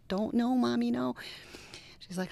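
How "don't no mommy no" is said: put-on higher, a child's frightened protest; pleading child, lightly acted; mock pleading child. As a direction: beg like a scared little kid. Child begging mother not to look.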